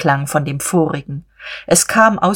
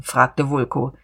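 ordinary delivery

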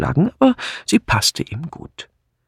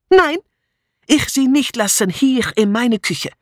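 put-on high-pitched, accented protest; high, outraged foreign cook; indignant refusal; 9 semitones higher than usual. A narrator's reading. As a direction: stubbornly defend your kitchen. Cook refuses to leave her kitchen.

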